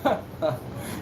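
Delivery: short soft unvoiced chuckle; breathy boss chuckle. Boss laughing off a player's contract demand, soft chuckle. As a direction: scoff quietly at an absurd demand.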